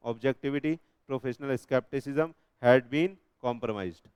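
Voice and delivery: very slow, word by word reading; slow dictation. Shown as a demonstration. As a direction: dictate it phrase by phrase for notes. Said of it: "teacher dictates definition".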